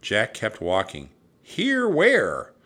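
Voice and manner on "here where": high, loud questioning shout; calling back. A narrator's reading. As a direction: shout back, puzzled where to go. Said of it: "he called back".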